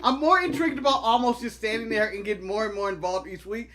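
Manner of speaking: ordinary talk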